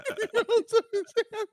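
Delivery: high, squeaky words through real laughter; helpless laughing. Real, not acted. Comic losing it at his own joke, helpless laughter. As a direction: wheeze it out, barely able to speak.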